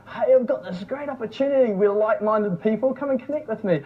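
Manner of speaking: high-pitched mock sales spiel. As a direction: parody a pushy opportunity seller. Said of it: "no sales".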